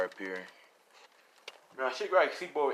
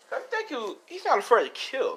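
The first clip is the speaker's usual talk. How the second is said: laughing; 11 semitones higher, 4 dB louder than usual. Real, not acted. Laugh heard while narrating.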